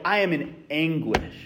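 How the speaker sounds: quiet, heavy admission of pain; anguished. Retold or imitated. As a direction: let it sink low and soft, like real despair. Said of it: "You're like".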